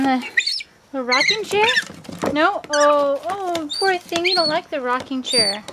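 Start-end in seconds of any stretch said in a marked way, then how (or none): none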